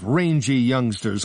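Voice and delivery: ordinary delivery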